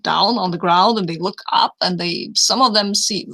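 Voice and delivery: usual speaking voice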